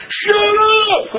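playful hammed-up pulpit yell of "Shut up!"; mock shout. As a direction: yell "Shut up!" way up high, half laughing. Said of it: "here you are in the pulpit saying".

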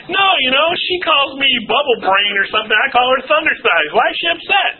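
high-pitched, baffled self-defence; clueless protest. Retold or imitated. Husband defending himself in marriage counseling, mock indignant.

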